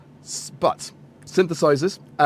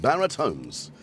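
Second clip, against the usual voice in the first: ordinary talk, nothing marked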